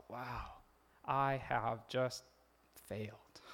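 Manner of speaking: low, soft, slow admission; crushed realisation. Acted. Peter realising he failed Jesus, hushed and slow. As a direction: let it sink in, word by word. Voices Peter's feeling after the denial.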